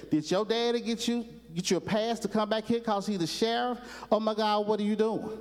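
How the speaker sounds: high, excited and quick; excited Gomer. Retold or imitated. Gomer gushing at Opie in the barracks, high and excited. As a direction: gush over the boy like a starstruck private. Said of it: "he got so excited".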